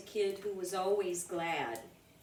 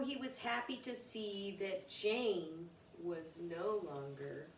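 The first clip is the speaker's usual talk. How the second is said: softer and lower to the close; fading ending; 4 semitones lower, 7 dB quieter than usual. A narrator's reading. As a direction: let the story fade out.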